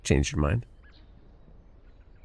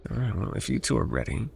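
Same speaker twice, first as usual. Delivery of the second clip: ordinary talk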